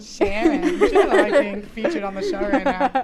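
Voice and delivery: loud, high and laughing; high laughing aside. Real, not acted. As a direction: burst out laughing mid-sentence. Laughing about mom.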